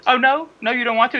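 lightly hammed-up, high taunting voice; mock her with a high, questioning lilt; taunting impression. Co-host acting out the wrestler's taunts, high mock voice.

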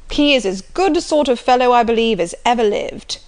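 loud, bluff country squire; jovial loud old gentleman; hearty Sir John. Acted. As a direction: boom it out like a jolly squire. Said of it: "repeated Sir John".

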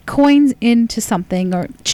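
usual speaking voice

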